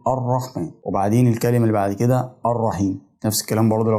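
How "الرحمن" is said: "الرحمن" as ar-rahman, opening on a; fatha at the start. Shown as a demonstration. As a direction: say "الرحمن" with a clear initial a. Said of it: "بقول".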